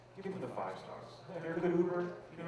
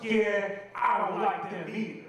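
cowboy fan; 6 semitones higher, 4 dB louder, slower than usual. Retold or imitated. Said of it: "He's like".

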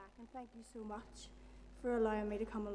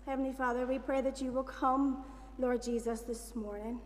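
soft, earnest prayer voice; 4 dB quieter than usual. Acted. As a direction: pray gently and sincerely to God. Leads the congregation in prayer.

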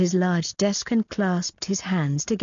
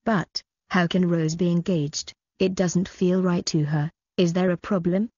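plain reading voice

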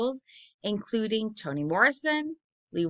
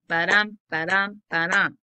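ba-dum with the dum punched louder; iambic ba-dum rhythm; 6 dB louder than usual. Shown as a demonstration. Teacher beating out iambic rhythm for the class. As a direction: tap out the iambic beat, weak then strong. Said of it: "it's unstressed followed by stress".

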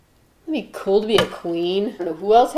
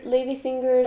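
usual speaking voice

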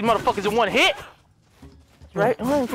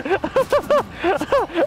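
high burst of laughter; long high cackling laugh; laughing fit; 8 semitones higher than usual. Real, not acted. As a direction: crack up helplessly. Cracks up after raging.

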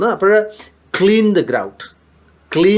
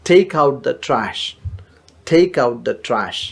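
slow model phrase; 3 semitones lower, much slower than usual. Shown as a demonstration. Teacher modelling a new word slowly for students. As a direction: stretch out each word of declutter the closet. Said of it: "take out the trash എന്ന് നമുക്കൂടെ അറിയാം".

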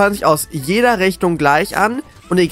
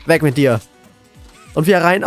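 ordinary delivery

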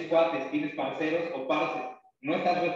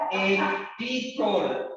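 Ecticor stretched out very slowly, pitched higher; drawn-out nickname; 4 semitones higher, much slower than usual. Retold or imitated. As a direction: linger incredulously on each syllable of Ecticor. Repeats nickname in disbelief.

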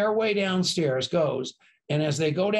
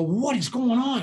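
startled, pitched-up question; alarmed; 7 semitones higher than usual. Retold or imitated. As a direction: cry out in alarm. Grabbed by two guys.

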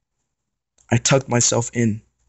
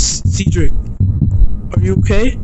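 higher, louder frantic question; panicked; 6 semitones higher, 5 dB louder than usual. Acted. Aries calling to the injured Cedric, panicked higher and louder. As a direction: cry out in fear for him. Friend hit by truck, panic.

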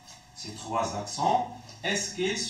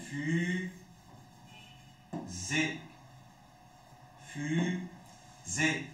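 fusée said slowly; 6 dB quieter, much slower than usual. Shown as a demonstration. Teacher repeating the model word, drawn out. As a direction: stretch Fusée out. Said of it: "comme un fusée".

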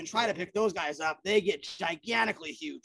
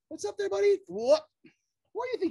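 pet talk; 9 semitones higher than usual. Real, not acted. Talking to the lizard.

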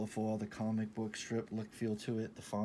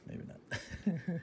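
silly whisper; 8 semitones higher than usual. Pretend. Joke reversal, silly voice.

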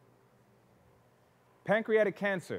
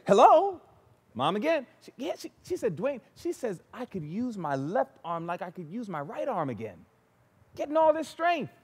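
much higher, bright and eager; excited; 4 semitones higher than usual. Retold or imitated. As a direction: share the joyful news eagerly. Mother recovering, voice lively.

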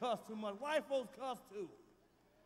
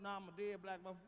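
usual speaking voice

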